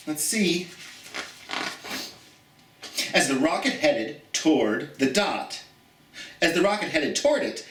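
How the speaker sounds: put-on gentle read-aloud storytelling for children; story reading. Teacher reading a picture book aloud to her students, storytelling.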